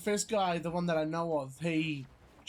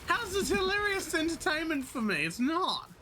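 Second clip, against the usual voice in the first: indignant; 11 semitones higher than usual. Real, not acted. Indignant reply to friend.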